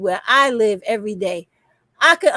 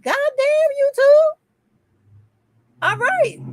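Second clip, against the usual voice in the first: high squeaky mock disbelief; mock dismay; 13 semitones higher than usual. Retelling her reaction to the strike, squeaky high dismay. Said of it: "I was like".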